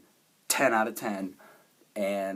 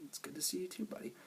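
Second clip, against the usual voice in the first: whispering; 9 semitones higher, 12 dB quieter, faster than usual. Retold or imitated. Quoting quiet reunion line.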